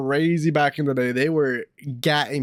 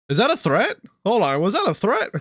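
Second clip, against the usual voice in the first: surprised; 6 semitones higher than usual. Real, not acted. Streamer reacts to sign-off line.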